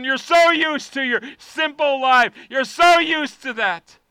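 shouted, rising prayer at full volume; fiery pulpit prayer; preaching at full force. Acted. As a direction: cry out to God to stretch you.

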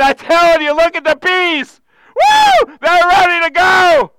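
high, loud, excited preacher voicing Jesus; amped up Jesus. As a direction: cheer like you can't contain it. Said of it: "Jesus getting all amped up".

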